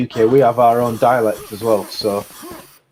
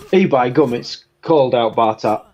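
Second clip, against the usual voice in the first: broad Yorkshire dialect, slow on cold out; a lightly played broad Yorkshire line; Yorkshire accent. Shown as a demonstration. Asked by friend for Yorkshire, broad Yorkshire dialect. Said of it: "tell us something in, uh, Yorkshire accent".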